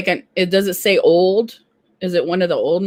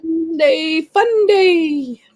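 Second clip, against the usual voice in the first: higher, slow sing-song cheer; drawn-out cheer; 9 semitones higher, much slower than usual. Real, not acted. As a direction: stretch it out like a happy chant. Cheering their live get-together.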